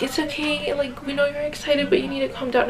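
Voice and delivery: high, soft coaxing voice to calm someone down; soothing nurses. Retold or imitated. Nurses soothing a crying new mother, high soft coaxing. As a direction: gently talk her down like a nurse.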